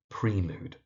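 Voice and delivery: prelude said PREE-lood; mispronunciation. Shown as a demonstration. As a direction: stretch prelude's first vowel to "ee". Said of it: "The mispronunciation you find is".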